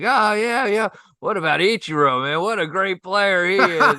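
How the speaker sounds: high, loud, slowed-down eager chat; hamming it up. Retold or imitated. Golfer hamming it up with Japanese partners, high eager chatter. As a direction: overdo the friendliness. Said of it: "I'm hamming it up with them now".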